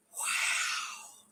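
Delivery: breathless whispered wow; hushed, amazed wow; awed whisper. Retold or imitated. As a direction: whisper it in disbelief. Stunned at being trusted.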